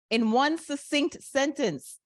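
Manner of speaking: "succinct" stressed in a high, slow phrase; emphasis on succinct. Real, not acted. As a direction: spell it out slowly, leaning on "succinct". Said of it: "with the emphasis on succinct".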